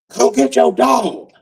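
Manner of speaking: high, drawn-out mock yell; retold yell. Ex yelling at him about the dog, high mock yell. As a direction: imitate an ex calling out, high and slow without real volume. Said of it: "yelled at me".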